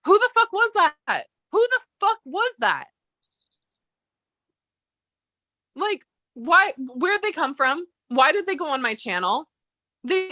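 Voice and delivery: high, incredulous demand for who that was; baffled outburst. Real, not acted. Asking the hosts who showed up on her channel, high and disbelieving. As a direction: pitch shoots up in disbelief.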